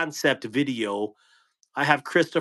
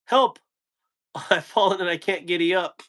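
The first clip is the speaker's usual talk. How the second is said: horse punchline; 6 semitones higher than usual. Acted. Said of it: "What did the horse say".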